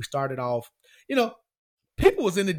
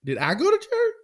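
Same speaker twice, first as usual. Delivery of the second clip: high, incredulous repeat of the question; surprised echo. Real, not acted. Caught off guard by his co-host's church question, surprised and high. Asked whether he went to church.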